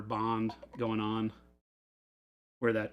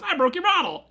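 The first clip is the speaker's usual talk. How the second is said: high, louder retold confession; mock breezy confession; 8 dB louder than usual. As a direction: announce it casually, pitched way up and louder. Retold Chris confessing to Jordan.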